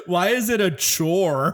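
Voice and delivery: laughing while asking. Real, not acted. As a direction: laugh out the complaint. Laughing complaint about episode.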